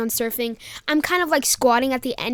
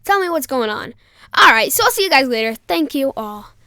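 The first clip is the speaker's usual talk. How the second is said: ordinary talk, nothing marked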